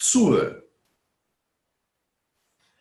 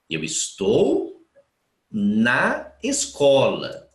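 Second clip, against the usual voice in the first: slow translation; much slower than usual. Shown as a demonstration. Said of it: "Veja que aqui também não tem contração".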